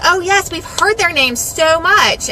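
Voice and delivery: mock-polite neighbor, slow and lifted; neighbors' polite remark. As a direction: slowly draw it out like a knowing neighbor. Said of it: "several of them ... said".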